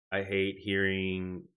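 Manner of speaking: ordinary talk, nothing marked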